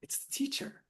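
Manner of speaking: hushed, breathless whisper of the answer; conspiratorial whisper. Acted. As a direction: whisper it like a secret reveal.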